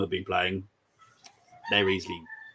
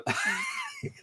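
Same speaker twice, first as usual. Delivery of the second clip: sudden high shriek of laughter; 6 dB quieter than usual. Real, not acted. Laughter bursts out again.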